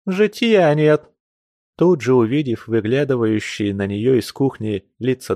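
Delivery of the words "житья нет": slow high tearful wail. A narrator's reading. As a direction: drag it out in tears. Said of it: "вопила она с плачущим".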